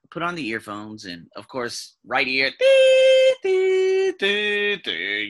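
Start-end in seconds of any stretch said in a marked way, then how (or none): 2.6-5.3 long high "dee" tones stepping down in pitch; imitated descending beeps. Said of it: "they go down to about the two thousand".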